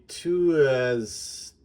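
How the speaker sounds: Tuesday broken up as "TOO-ez-day"; slow, over-literal "tu-es-day" for Tuesday; literal misreading. Shown as a demonstration. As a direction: sound Tuesday out slowly with an extra "ez". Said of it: "this is not really good".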